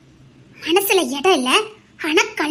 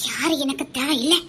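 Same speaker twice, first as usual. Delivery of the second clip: low, much softer muttered refusal; bitter murmur; 7 semitones lower, 7 dB quieter than usual. Acted. Aadhira turning her back on everyone, low bitter murmur. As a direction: say it almost to herself.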